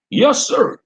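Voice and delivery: high, loud snappy yes sir; deputy answering the sheriff; deputy's reply. Acted. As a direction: answer the boss crisply. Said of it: "he replied back".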